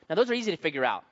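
ordinary talk

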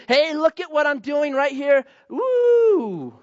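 preacher mocking a showy giver, boastful whoop; mock show-off. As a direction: show off your gift to everyone.

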